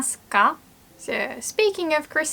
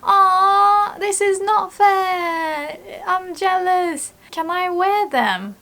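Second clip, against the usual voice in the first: whiny sister; 8 semitones higher, slower than usual. Retold or imitated. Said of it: "my sister is like".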